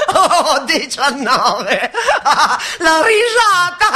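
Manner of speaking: calling a number through laughter; laughing. Acted. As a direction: laugh out the call.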